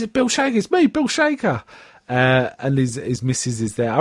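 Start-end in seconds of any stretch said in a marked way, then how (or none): 0.0-1.6 high, eager self-introduction; Bill Shaker. Retold or imitated. Bill Shaker insisting to Dougie, high and eager. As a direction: pitch up, insisting he's an old friend. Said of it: "he says".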